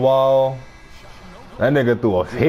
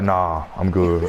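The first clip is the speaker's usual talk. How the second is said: low slow brush-off; 10 semitones lower, slower than usual. Retold or imitated. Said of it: "He was like".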